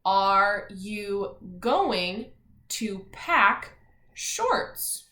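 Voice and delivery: very slow word-by-word question; reading together. Shown as a demonstration. Teacher leading the class reading aloud, slow and deliberate. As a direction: read it out slowly for the class to join. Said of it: "let's read it all together".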